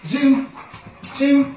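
very high, loud repeated zoom; high insistent cue. Real, not acted. Trainer urging the dog to spin, high insistent cue. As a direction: push the cue up high twice.

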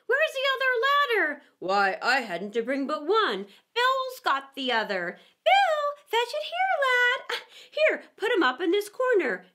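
high, loud bustling shouts of many voices; hurried workmen calling to each other; crowd of workers. A narrator's reading. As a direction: creatures putting up ladders at the house.

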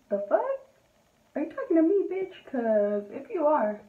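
acting out an indignant comeback; confrontational comeback. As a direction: square up to her, pitch jumping up. Acted confrontation with the woman.

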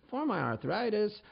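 put-on high, slow old-lady voice; mock old woman. Old cook excuses taking the weed, high mock old-lady voice. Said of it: "this old woman who must be".

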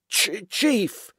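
high, stammered address; terrified but determined. A narrator's reading. Basil approaching his chief with facts, frightened stammer. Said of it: "Terrified but determined".

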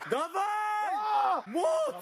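high, drawn-out rallying yell; shouted encouragement. Real, not acted. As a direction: yell to push him through the rep. Cheering on a lifter mid-effort.

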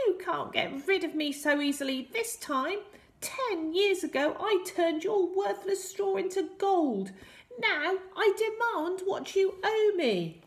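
squeaky little villain making demands; high, loud, menacing imp. A narrator's reading. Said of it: "in a high squeaky voice".

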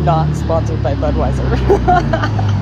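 slow, loud ad-style disclaimer; mock disclaimer. To the viewers about Budweiser, a loud slow mock disclaimer. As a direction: boom it out like a legal notice. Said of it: "joke disclaimer".

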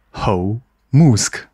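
"Musk" set apart after a drawn-out "吼"; corrected Musk. Retold or imitated. Host repeating the correction to himself, corrected "Musk". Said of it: "这个应该要念Musk啦".

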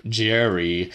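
ordinary delivery, nothing marked

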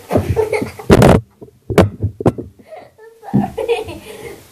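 long, high laughing fit; helpless laughter. Real, not acted. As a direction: burst out laughing.

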